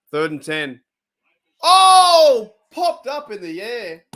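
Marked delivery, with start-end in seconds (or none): 1.6-4.0 loud high startled cry, then slow; startled yelp. Real, not acted. Gamer startled by a figure popping up, loud yelp. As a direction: jump at the scare.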